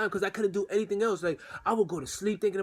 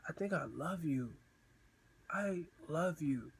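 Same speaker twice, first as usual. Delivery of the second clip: hesitant confession; 8 dB quieter, slower than usual. Retold or imitated. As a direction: confess it quietly, pausing before you repeat it. Hesitant first love confession.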